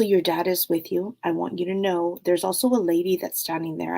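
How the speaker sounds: usual speaking voice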